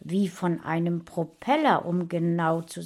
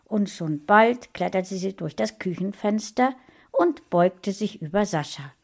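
ordinary delivery

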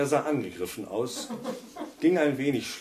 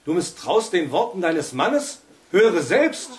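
higher, louder indignant demand; flaring up; 8 semitones higher, 9 dB louder than usual. A narrator's reading. Husband demands his wife listen for herself, indignant. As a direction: insist hotly, wounded pride. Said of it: "brauste Felix auf".

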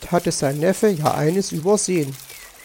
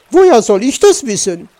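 loud, higher indignant retort; calling out; 5 semitones higher, 9 dB louder than usual. A narrator's reading. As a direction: throw the question back loudly. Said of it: "rief sie". (laut und hoch gerufen)

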